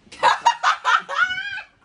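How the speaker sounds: loud, very high-pitched burst of laughter; real laughter. Real, not acted. Host cracks up introducing the reactions, loud high laughter. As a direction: crack up loudly.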